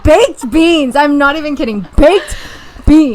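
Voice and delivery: laughing while repeating it; giggling through the words. Real, not acted. Laughing repeat of her role.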